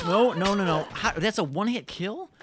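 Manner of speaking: high, loud protesting no; real outrage; outraged disbelief. Real, not acted. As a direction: cry out at the unfair kill. Killed in one hit.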